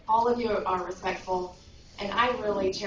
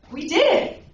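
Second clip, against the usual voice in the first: high, loud call bouncing back; ringing, high-pitched reply; echoing shout; 8 semitones higher, 10 dB louder than usual. Retold or imitated. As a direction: echo the boy's triumphant cry. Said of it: "voice respond that says".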